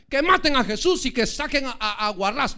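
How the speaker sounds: loud, stumbling shout from the mob; crowd cry. Acted. Egged-on crowd demands Jesus' death, loud garbled cry. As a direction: call it out loudly, fumbling the name. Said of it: "Vas a ir a gritar, vamos a gritar".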